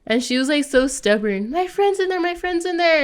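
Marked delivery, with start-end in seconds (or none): 1.5-3.0 pitched-up nagging repeat; high whiny mimic. Mimicking the stubborn girl at the door, high whine.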